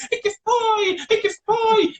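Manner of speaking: high, frightened mother's cry; startled mom. Retold or imitated. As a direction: shriek it like a scared mom in the dark.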